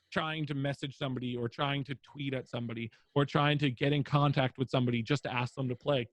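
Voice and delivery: ordinary talk, nothing marked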